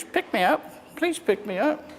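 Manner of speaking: high, soft little frog pleading; tiny frog voice. Acted. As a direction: squeak it up from below like a small frog.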